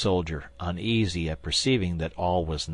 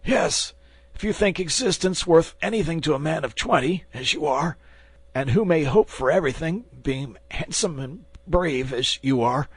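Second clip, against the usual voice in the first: high, pleading flattery; butter him up to stay alive; wheedling bandit; 8 semitones higher than usual. Acted. Wounded bandit flatters the young man for his life, high wheedling voice.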